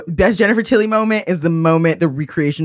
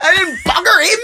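imitating Gina Gershon; 4 dB louder than usual. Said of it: "Gina Gershon's like".

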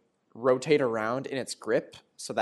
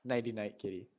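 soft goodnight to a kitty; sleepy talk to pet; 8 dB quieter than usual. Pretend. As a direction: lull the kitty to sleep, softly. Bidding knocked-out jaguar goodnight.

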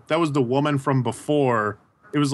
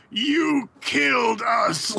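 high, drawn-out accusing cry; mock-dramatic wail of blame, slow and high; Captain America; 8 semitones higher, much slower than usual. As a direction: stretch out a hammed-up accusation. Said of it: "Captain America, like, grabbed Tony and he was like".

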